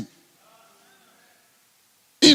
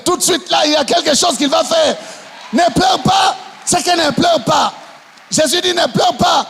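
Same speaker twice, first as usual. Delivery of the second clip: full-force sermon delivery; fiery preaching; 7 semitones higher than usual. Acted. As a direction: rouse the congregation. Preaching climax.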